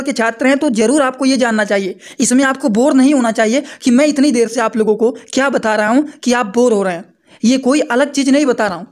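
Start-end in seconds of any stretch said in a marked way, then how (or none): none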